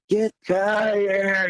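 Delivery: long, held mock holler, words slurred together; imitated gigantic yell. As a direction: stretch it out like a huge yell, without real volume. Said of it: "we hear the same just gigantic".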